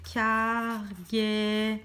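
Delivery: CARGAISON very slow, syllable by syllable; sounded-out word. Shown as a demonstration. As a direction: draw out car, gai, son in CARGAISON. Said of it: "quand je colle mon mot".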